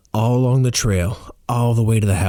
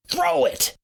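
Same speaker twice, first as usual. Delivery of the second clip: very high, urgent yell, volume held back; yelled order; 4 dB quieter than usual. A narrator's reading. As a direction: shriek the command. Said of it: "Roar yells".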